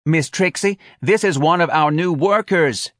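high, chirpy introduction; eager office manager. A narrator's reading. Manager introducing new worker to old employee, eager.

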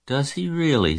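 high, mildly absent response; vague. A narrator's reading. Mother half-answers daughter's tale of spider, vague. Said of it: "said Missus Arable rather vaguely".

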